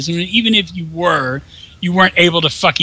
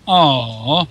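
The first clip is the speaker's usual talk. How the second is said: normal talking voice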